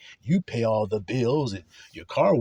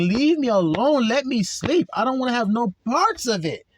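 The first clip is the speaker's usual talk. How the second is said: mock upset plea, higher and louder; imitating angry plea; 9 semitones higher, 4 dB louder than usual. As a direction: act out being woken and annoyed. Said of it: "gets upset and starts cussing and getting angry".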